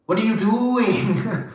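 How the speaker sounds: high, slow, drawn-out disbelief; stretched exasperation. Retold or imitated. Repeating the question to the person, high and slow in disbelief.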